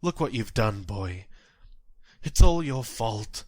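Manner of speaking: bitter blame. Acted. Old man blames boy before suicide.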